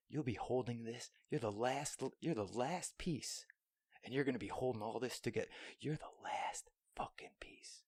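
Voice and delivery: soft coaxing whisper. Retold or imitated. Whispering a pep talk to a tiny piece of hardware. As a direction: coax it gently under your breath. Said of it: "I started whispering to it".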